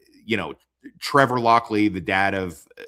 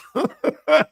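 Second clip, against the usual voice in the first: short high laugh; 8 dB louder than usual. Real, not acted.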